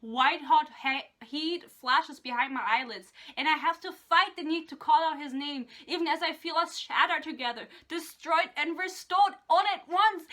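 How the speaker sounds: hammed-up romance-novel recital; dramatic reading. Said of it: "I'm gonna read it out".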